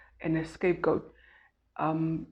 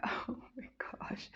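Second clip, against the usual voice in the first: whispered; 7 semitones higher, 15 dB quieter, slower than usual. Retold or imitated. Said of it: "I get emotional just thinking".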